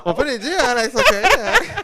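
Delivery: real laughter breaking through the words; laughing out the punchline. Real, not acted. Laughing at the accent joke.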